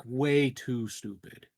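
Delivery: ordinary talk, nothing marked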